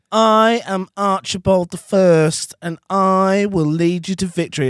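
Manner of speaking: loud, high, stretched-out battle proclamation; method-acting kid. Unfazed kid rallying a game server, grand method-acting proclamation. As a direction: declaim it slowly like an unfazed war leader. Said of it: "just continuously proper method acting".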